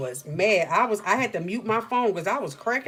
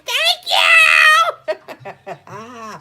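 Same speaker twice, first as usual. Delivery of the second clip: much higher, much louder laughing thank you; gleeful shout; 12 dB louder than usual. Retold or imitated. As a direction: shriek it out in triumph, laughing. Gleeful retort ending the call.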